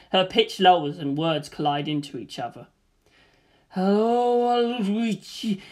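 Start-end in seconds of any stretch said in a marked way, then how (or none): 3.7-5.6 slow, warped and stretched out; distorted voice. Acted. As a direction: let the words sag and smear, very slowly. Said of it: "the shadow's voice becomes distorted".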